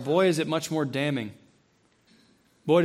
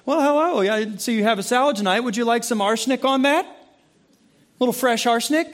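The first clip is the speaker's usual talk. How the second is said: mock waiter, higher and louder; chirpy waiter; 5 semitones higher, 4 dB louder than usual. Waiter greeting a diner at the table, mock-cheery. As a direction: play an over-friendly waiter offering a topping. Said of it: "if the waiter comes over and goes".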